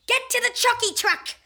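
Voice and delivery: loud high urgent yell; shouting. Acted. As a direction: bark the escape order. Villain shouts escape order.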